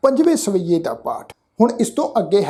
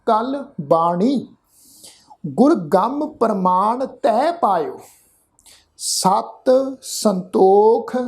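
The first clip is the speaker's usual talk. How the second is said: slow, careful verse reading with pauses; slow recitation; much slower than usual. Shown as a demonstration. Teacher showing the sangat how to recite. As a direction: lay out every word for listeners to copy.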